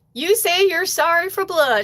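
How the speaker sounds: high-pitched imitation Tennessee drawl; put-on Southern twang, pitched well up; Chattanooga Southern drawl. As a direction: scold teasingly in a mock Southern accent. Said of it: "really good Chattanooga, Tennessee, like, Southern drawl".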